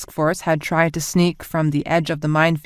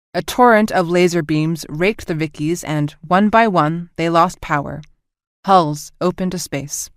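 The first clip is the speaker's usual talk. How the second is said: usual speaking voice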